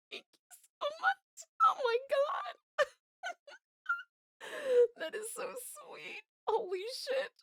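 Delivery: laughing and crying at once, deeply moved; tearful thanks. Real, not acted. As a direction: thank them through happy tears. Moved to tears by a donation.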